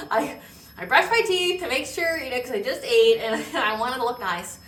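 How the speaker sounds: high, loud, talking through a laugh. Real, not acted. Explaining the toothbrushing to viewers, laughing. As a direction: giggle your way through the confession. Laughs admitting vanity about brushing teeth.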